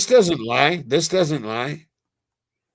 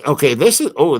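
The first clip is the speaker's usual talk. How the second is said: ordinary delivery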